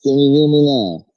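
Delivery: muffled, as if inside a fishbowl. Real, not acted. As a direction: voice muffled through a bad mic. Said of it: "No, you're still muffled".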